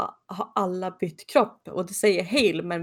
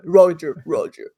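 deeper sexy voice; 2 semitones lower, 7 dB louder, slower than usual. Retold or imitated. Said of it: "går hon ännu lägre med röst".

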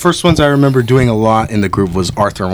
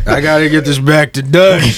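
slow cowboy outlaw drawl; Arthur Morgan; 2 semitones higher than usual. Acted. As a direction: drawl it like an Old West gunslinger. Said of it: "How would it sound like if Arthur Morgan".

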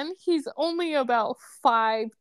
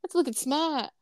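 mock Boston accent; Boston accent, r's dropped; 3 semitones higher than usual. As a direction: quote a Boston local in accent. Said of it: "I don't know how the Boston accent is hot".

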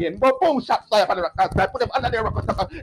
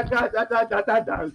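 ordinary talk, nothing marked

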